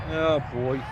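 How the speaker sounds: normal talking voice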